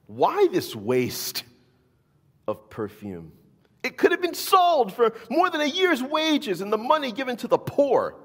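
high, loud indignant protest; indignant disciples. A narrator's reading. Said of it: "saying indignantly to one another".

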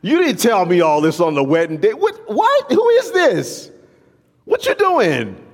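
hammed-up who-is-this shock; shocked spouse. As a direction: play a spouse stunned by a stranger at home. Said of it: "and you're like".

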